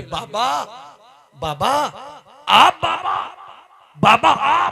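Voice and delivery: high, loud cries of Baba, half laughing half sobbing; overwhelmed joy. Acted. Woman greeting the saint at her door, overjoyed. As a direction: call out as if you cannot believe your eyes.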